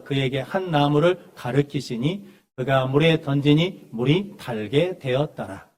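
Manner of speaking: loud, slow reading aloud; slow, deliberate Bible recitation; scripture reading. Acted. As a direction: lead the congregation in reading a verse, measured and projected. Leads congregational scripture reading.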